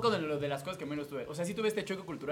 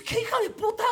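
shocked outrage; 4 dB louder than usual. Retold or imitated. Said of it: "que dices".

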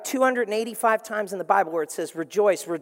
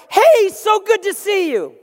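much higher, louder, slow gushing greeting; overly cheerful; 12 semitones higher, 10 dB louder, slower than usual. Retold or imitated. Happy person greeting a grump, much higher and louder gushing. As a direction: beam at them like the happiest person alive. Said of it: "they're like".

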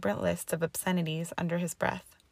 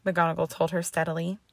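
ordinary delivery

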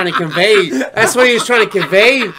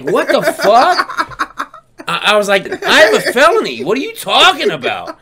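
laughing disbelief; 7 semitones higher, 6 dB louder than usual. Retold or imitated.

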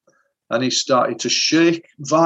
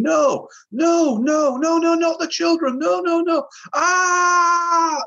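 re-enacting a scream, much higher, crying out; desperate wailing no, cracking into a cry; imitating a hypnotised client screaming as soldiers find his family; terrified screaming; slower than usual. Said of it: "he's screaming".

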